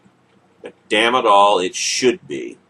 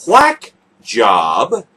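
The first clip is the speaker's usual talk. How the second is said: John McCain impression; 6 semitones lower, 4 dB louder than usual. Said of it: "in his best John McCain impression".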